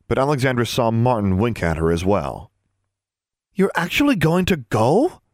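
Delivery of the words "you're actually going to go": high, rising disbelief; incredulous. A narrator's reading. Anna to her roommate about going, incredulous. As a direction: question it as if you can't believe it. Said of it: "Anna asked incredulously".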